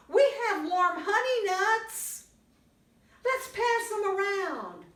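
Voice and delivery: high, bright critter voice; chirpy little creature; small animal voice. Acted. Gopher and Mole voiced.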